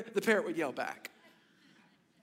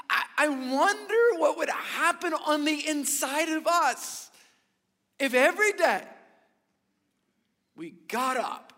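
higher and louder, voice close to crying; tearful; 5 semitones higher, 4 dB louder than usual. Real, not acted. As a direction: plead with the church, near tears. Emotional climax of sermon.

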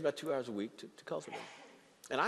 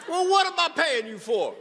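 much higher and louder, joking outrage; mock indignation; 14 dB louder than usual. Pastor teasing his senior associate, mock outrage. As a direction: bust him playfully for his answer.

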